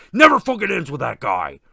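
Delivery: usual speaking voice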